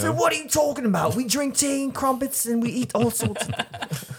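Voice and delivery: very high squeaky fake British voice; mock British accent. Mocking Brits about tea and crumpets, squeaky fake British accent. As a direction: squeal it like a caricature Brit. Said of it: "we tried to imitate the accent".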